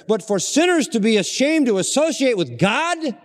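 preacher thundering at ashamed disciples; impassioned preaching. Acted. Preacher rebuking the disciples' shame, loud and high. Preacher rising to full force.

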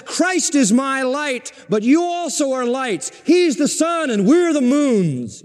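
high, emphatic pulpit proclamation; preaching. Real, not acted. Preacher telling believers they also are lights, proclaiming it high.

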